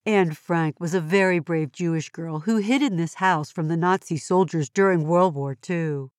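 ordinary talk

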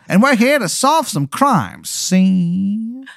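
high, slow wiseguy drawl; old-timey gangster introducing himself; 1920s gangster. Acted. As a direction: cactus gangster saying his name. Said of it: "And they go".

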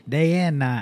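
playful Southern drawl; put-on Southern accent. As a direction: lean lightly into a Southern accent. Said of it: "What are you doing with that accent".

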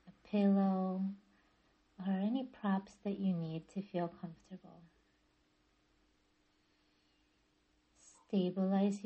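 calm yoga nidra guidance with long pauses; guided meditation. Acted. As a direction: ease listeners into stillness, phrase by unhurried phrase. Leads guided relaxation practice.

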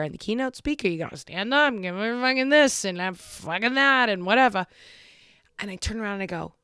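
ordinary talk, nothing marked

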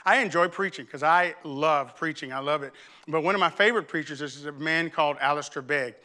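ordinary talk

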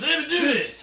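high, shouted soul-singer squeal; high-pitched soul shout. Acted. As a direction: yelp it out like a funk showman. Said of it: "You sound like [name]".

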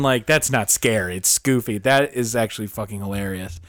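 ordinary delivery

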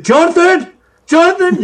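high-pitched calling out; imitated yelling. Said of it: "he starts yelling".

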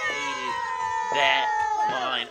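high, very slow stressed hated; hated dragged out high; drawn-out emphasis. Real, not acted. Stressing his dislike.

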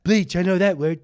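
high, loud blurting; Trump. Acted. Redoing the impression on request, blurted Trump impression. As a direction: blurt it out as a mock president. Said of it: "do that again?".

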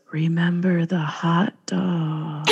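slow, whispered ghostly message; mock eerie whisper. Cardboard castle's message to Caitlin, eerie whisper. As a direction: whisper it slowly like an omen.

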